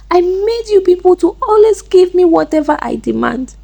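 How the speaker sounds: high-pitched, agitated outpouring; mild shouted confession. A narrator's reading. Chisom on the ground confessing to Amaka, agitated cry. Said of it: "started shouting, shaking and confessing".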